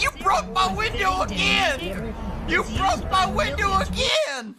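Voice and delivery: high, slow mock yell; imitating a shriek. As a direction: wail it like the panicked man. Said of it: "he's like".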